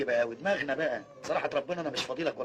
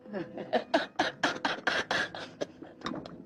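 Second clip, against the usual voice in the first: long, rising wheeze of laughter; wheezy high laugh; 8 semitones higher, 4 dB quieter than usual. Acted. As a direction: let the laugh climb and run out. Laughs after his story. (ضحكة رفيعة طويلة)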